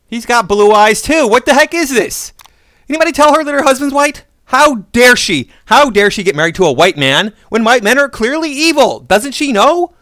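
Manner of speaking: high, loud pretend indignation; a hammed-up scandalised gasp of a rant; mock outrage.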